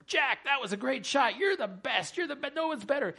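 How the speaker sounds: a hint of a fawning caddy cheering him on; eager caddy.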